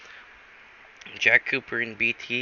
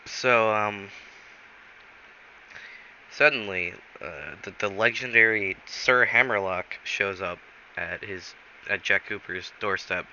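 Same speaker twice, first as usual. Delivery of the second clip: plain reading voice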